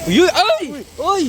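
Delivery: high, loud yelps of surprise; real startled yell. Real, not acted. Fisherman spotting the catch, startled shouts. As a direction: yelp as the fish suddenly shows. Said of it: "Pati kami".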